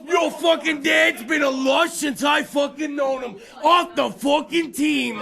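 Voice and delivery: loud, high, dragged-out shouted refusal; furious coach. Retold or imitated. Hockey coach yelling back at a parent from the bench.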